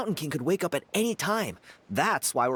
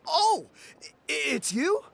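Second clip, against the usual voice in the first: sudden high startled recognition; surprised; 11 semitones higher, slower than usual. Acted. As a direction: caught off guard meeting a hero. Recognises the Flame Bearer.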